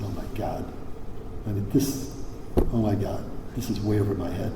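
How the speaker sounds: half-whispered to God in disbelief; a quiet retold gasp of awe; overwhelmed prayer. On knees after election.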